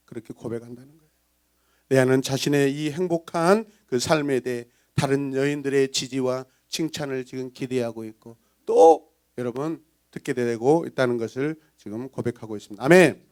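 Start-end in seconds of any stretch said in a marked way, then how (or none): none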